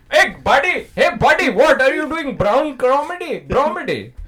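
put-on high, loud Indian accent; loud heckling in an Indian accent. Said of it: "Indian Mister Freeze".

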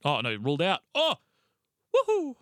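high, loud whoop at the news; rising excitement into a high whoo-hoo; excited reaction. Real, not acted. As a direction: react with a sudden burst of glee. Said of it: "Sorry, I'm not excited".

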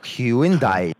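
Hyundai as HYU-in-DYE, drawn out in three parts. Shown as a demonstration. Caller plays his version to the helper, Hyundai stretched to hyu-in-dye. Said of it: "I heard three part from you".

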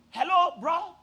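calling out loud and high across the hall; retold scream. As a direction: yell after him, voice rising. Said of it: "Then I started screaming".

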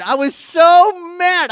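high, loud, stretched-out outburst; worked-up exasperation. Retold or imitated. Pastor reliving his anger at the installer, loud exasperation. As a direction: lean hard and long on how angry it was.

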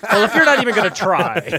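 high, loud mock indignation; mock indignant. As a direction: play it hurt and huffy. Jokey mock-offended quip.

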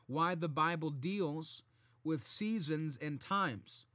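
normal talking voice

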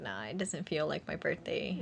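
soft, dejected brush-off; downcast. Retold or imitated. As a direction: turn it down quietly, no spark. Downcast reply to cheering friend.

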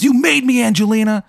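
high squeaky mock-dramatic line; tattoo voice. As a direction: pitch way up, melodramatic. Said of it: "and he goes".

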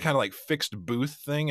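usual speaking voice

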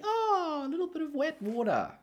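very high, excited mock squeal; mock delight. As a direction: put on a high voice of playful delight. Playfully imagines the tube rider's reaction.

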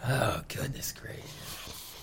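slow breathy whisper under the breath. Real, not acted. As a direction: whisper it to yourself, amazed. Whispered aside.

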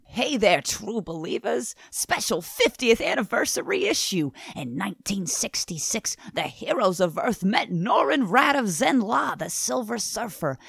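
put-on hammy showman with a New York accent; Stan Lee voice. Co-host performs the anniversary solicitation on request, Stan Lee voice. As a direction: hype it up like an old comics pitchman, New York accent. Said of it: "A Stan Lee voice".

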